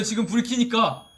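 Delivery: ordinary talk, nothing marked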